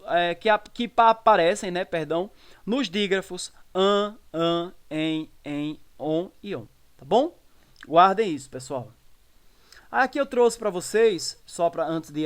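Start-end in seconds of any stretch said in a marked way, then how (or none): 3.7-6.7 "an, em, on" as nasal vowels, slowly. Shown as a demonstration. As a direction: sound out each of "an, em, on" through the nose. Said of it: "nas vogais nasais". ("an, em, on" com vogais nasais)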